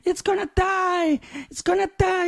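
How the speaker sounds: high, wailing mock panic; freaking-out wife. Wife fears for the tree in husband's hands, mock panic. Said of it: "my wife is freaking out".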